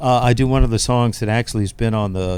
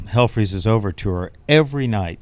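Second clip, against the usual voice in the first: ordinary talk, nothing marked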